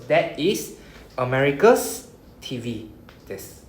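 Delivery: "America's T V desu" spoken slowly and evenly; teacher translates the example for students, slowly; slow gloss. Shown as a demonstration. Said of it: "teacher glosses slowly".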